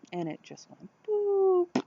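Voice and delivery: high squeaky boop sound effect; playful boop. Retold or imitated. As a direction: chirp the boop like a cartoon. Bike tipping over, mimicked sound.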